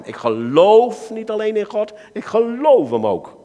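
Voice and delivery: ordinary talk, nothing marked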